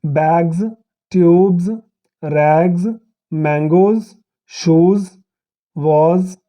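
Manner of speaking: each word said alone, the ending of "बैग्स" and "शूज" buzzed as a voiced z; careful list, every final s voiced like "ज़", slowing at the end. Shown as a demonstration. Teacher to students, showing the s that sounds like z. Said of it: "एस जब ज का sound pronounce करता है".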